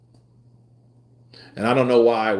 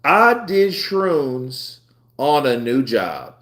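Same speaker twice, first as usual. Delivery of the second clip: slow, each word set apart; slow deliberate repeat; 4 semitones higher, 3 dB louder, much slower than usual. Shown as a demonstration. Retelling his confession to friends, slow and deliberate. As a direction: let it land, said slowly. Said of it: "Let that, hold on, pause".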